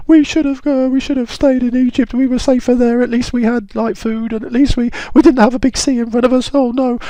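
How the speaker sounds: very high, loud moaning complaint; a squeaky, loud wail of complaint; moaning Israelites. Acted. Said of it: "Israelites are moaning".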